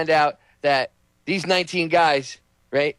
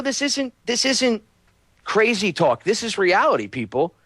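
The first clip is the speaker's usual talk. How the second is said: normal talking voice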